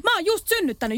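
mild retold shout, pitch thrown up; retold yell. Mother yelling at a rude man in a shop, mild mock yell. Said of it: "huusin sille".

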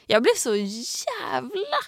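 "jävla" drawn out slowly in exasperation; exasperated emphasis. Real, not acted. Venting to a friend about the caretaker, exasperated stretch on "jävla".

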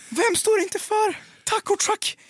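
very high, drawn-out rhetorical question; incredulous build-up. Retold or imitated. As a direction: stretch out the suspense before the reveal. Builds suspense before revealing culprit. (gäll, utdragen, misstrogen fråga)

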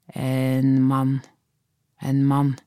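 lightly voiced child, drawn-out monotone; a hint of a nervous boy; boy's reluctant answer. A narrator's reading. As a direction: let it out reluctantly on one note. Nervous boy reluctantly reveals what he saw.

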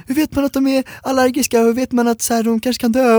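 mother on the phone in full panic; panicked mom. Retold or imitated. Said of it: "hon var så här skitpanik".

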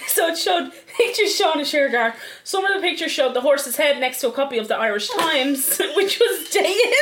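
high voice after laughter; after laughing hard. Real, not acted. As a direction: read on, still giggling. Narrating while still laughing.

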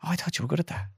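high, soft voice of the let-down bosses; imitating disappointed listeners; retold disappointment. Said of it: "you could see the disappointment".

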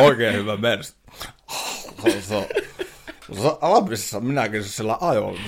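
put-on Russian accent. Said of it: "tämmönen joku venäläisaksentti tälle Veskulle".

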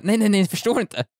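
high-pitched, drawn-out flustered protest; mock panic. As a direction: act out panicked denial. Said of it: "han kommer få panik".

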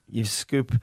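ordinary talk, nothing marked

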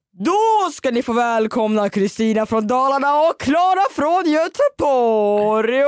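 drawn-out, sing-out microphone welcome; imitated announcer shout. Viking host welcoming two guests to the room, imitated announcer call. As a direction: mimic a host bellowing out a welcome, stretching the last word. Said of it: "skriker över hela lokalen".

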